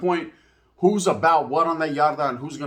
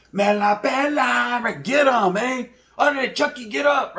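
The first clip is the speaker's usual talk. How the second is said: higher, jeering call from the crowd; mocking spectators; 7 semitones higher than usual. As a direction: egg them on like a heckler. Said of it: "they're gonna be like".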